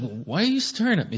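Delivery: mildly put-on discomfort at being stared at; imagine asking an unnerving starer why; baffled objection.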